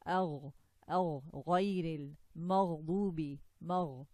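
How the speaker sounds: agh with a voiced gargled gh, no rush of air. Shown as a demonstration. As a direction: draw out the voiced gh in maghdubi and magh. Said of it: "Okay, with no air".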